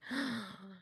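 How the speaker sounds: soft, high-pitched gasp. Retold or imitated. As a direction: draw a sharp breath in realization. Retold reaction to husband's remark.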